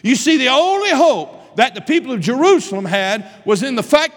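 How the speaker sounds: high, loud pulpit preaching; fiery preacher in full flow; full-force preaching. Acted. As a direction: preach it out to the back pews.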